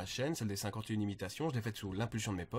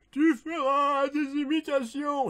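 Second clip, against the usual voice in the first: put-on high, slow, loud prophetic old man; 7 dB louder, slower than usual. Old man in a cave foretelling a destiny, high drawn-out cry. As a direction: wail it slowly like a hammed-up ancient hermit. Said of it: "il y a un vieux qui m'a fait".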